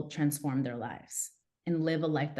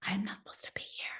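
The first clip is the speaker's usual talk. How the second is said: softer, whispered to herself; inner whisper; 2 semitones higher, 11 dB quieter, faster than usual. Retold or imitated. Her inner voice at the office, whispered. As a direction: whisper it like a secret thought. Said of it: "And inside".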